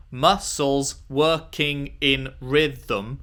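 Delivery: "Muscles working in rhythm" spoken very slowly, syllable by syllable; counting syllables. Shown as a demonstration. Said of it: "Haikus are five seven five".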